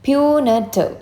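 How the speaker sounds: "Punitive" high, falling on the last syllable; pronunciation demo. Shown as a demonstration. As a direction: model "Punitive" clearly for learners. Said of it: "ഈ വേർഡിൻ്റെ pronunciation".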